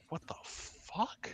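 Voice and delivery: slow, whispered disbelief; hushed, drawn-out bewilderment; stunned whisper. Acted. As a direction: whisper it slowly in shock. Kale hears laughter from the bar.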